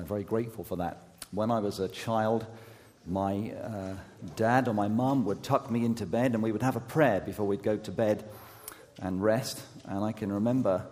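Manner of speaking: usual speaking voice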